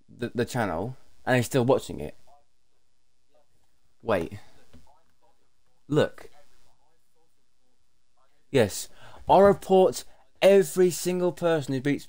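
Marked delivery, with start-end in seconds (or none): none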